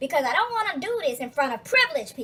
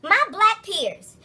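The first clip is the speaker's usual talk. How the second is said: slow, loud mock preaching; mocking a kid's preachy slow lecture; 6 semitones higher, 6 dB louder, much slower than usual. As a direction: drag out the words like a pompous kid lecturing.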